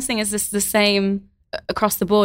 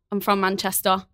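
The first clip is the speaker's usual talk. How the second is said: Manchester accent. Shown as a demonstration. Guest obliges host's request on stage, Manchester accent. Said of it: "can you do a Manchester accent".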